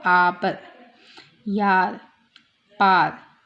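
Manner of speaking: आपर, यार, पार with the आ held long, low and loud. Shown as a demonstration. Teacher showing long sound, drawn-out आ in आपर, यार, पार. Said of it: "long sound आ".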